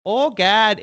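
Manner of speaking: high-pitched flat Buffalo accent. Shown as a demonstration. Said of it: "Can you do a Buffalo accent?".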